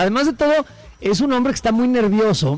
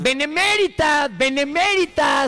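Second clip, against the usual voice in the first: benemérita stretched out slowly, pitched high; high, slow mocking benemérita; mock slow pronunciation; 9 semitones higher, slower than usual. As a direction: spell out benemérita slowly, syllable by syllable. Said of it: "Lo repite".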